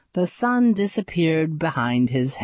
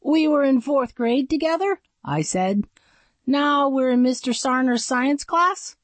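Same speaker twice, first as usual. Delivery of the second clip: nervous questioning voice; 8 semitones higher than usual. Acted. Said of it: "everything I said came out like a question".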